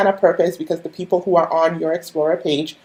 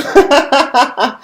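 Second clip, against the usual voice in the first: high giggle; 8 semitones higher than usual. Real, not acted. Laughing at herself on stream.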